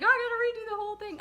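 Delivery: high, fast flustered panic; panicked. Retold or imitated. Retelling her panic over the book cover. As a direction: rattle it off like you're freaking out. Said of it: "I was like".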